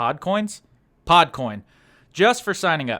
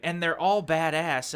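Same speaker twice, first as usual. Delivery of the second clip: usual speaking voice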